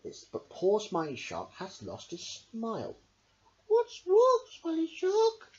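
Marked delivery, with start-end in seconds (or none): 3.7-5.5 high, bright sea creature voice; little Starfish. A narrator's reading. Starfish asking the shark what is wrong, high voice. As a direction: ask it gently in a tiny high voice. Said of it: "asked Starfish".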